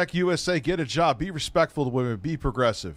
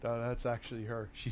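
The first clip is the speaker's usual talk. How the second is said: usual speaking voice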